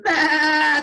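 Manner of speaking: a high, loud bleat; goat bleating. Acted. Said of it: "and going".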